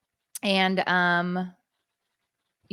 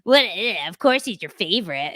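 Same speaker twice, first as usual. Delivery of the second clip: mock disgust; 5 dB louder than usual. Husband teasing wife about favorite son, mock disgusted. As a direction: tease her with mock disgust. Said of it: "And Dave was like".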